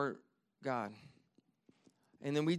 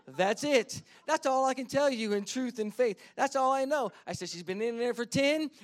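higher, louder, building sermon drive; preaching at full force; 5 semitones higher, 4 dB louder than usual. Acted. Pastor builds to the point for his congregation, preaching high and loud. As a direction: preach it home with fire.

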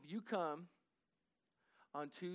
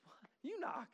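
incredulous; 7 semitones higher, 4 dB quieter, slower than usual. Retold or imitated. Retold pastor's disbelief at God.

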